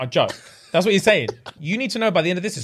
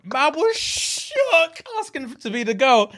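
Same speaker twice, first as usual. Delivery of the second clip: mock high shaky voice; 7 semitones higher, much slower than usual. Mocking Harry's nerves.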